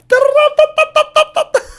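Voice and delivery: fast high staccato ta-ta-ta imitation of speaking in tongues; tongues babble. Describing his Pentecostal friend catching fire, imitated tongues babble. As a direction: rattle off syllables like a burst of tongues.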